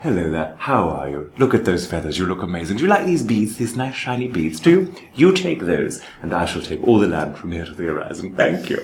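mock-courteous British swindler; charming British colonizer. British colonizer trading beads for land, charmingly British. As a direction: sweet-talk in a put-on British accent. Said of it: "they have that voice".

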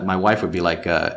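usual speaking voice

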